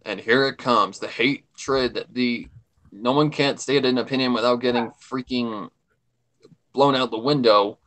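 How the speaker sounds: ordinary talk, nothing marked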